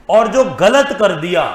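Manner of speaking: firm, measured statement of a rule; emphatic key rule. Real, not acted. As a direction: drum it in deliberately. Second half of key rule.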